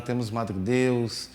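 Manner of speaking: ordinary delivery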